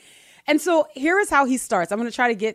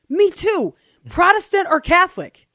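higher and louder, keen questioning; second man voiced eager and loud; eager stranger; 8 semitones higher, 8 dB louder than usual. A narrator's reading. Voicing second man in joke.

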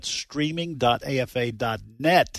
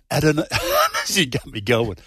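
usual speaking voice

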